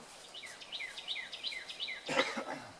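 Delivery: raspy smoker's cough mid-cigarette; a dry hacking cough. Real, not acted. Coughs while smoking.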